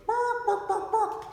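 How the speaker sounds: high, loud, squawked chicken bark; squawking chicken. Acted. Chicken in the joke speaks.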